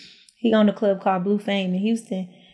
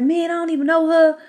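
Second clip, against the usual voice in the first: dismissive denial; 6 semitones higher, 3 dB louder, faster than usual. Retold or imitated. Boyfriend denying knowing her.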